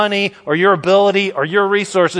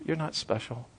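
much softer echo, almost breathed; near whisper; 6 semitones lower, 16 dB quieter than usual. Acted. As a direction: let it fade almost to nothing. Repeated line, dropping away.

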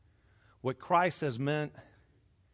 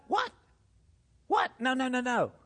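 higher, protesting; hammed-up no no no; mock refusal; 8 semitones higher than usual. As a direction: refuse it in comic alarm. Comic refusal of the valley.

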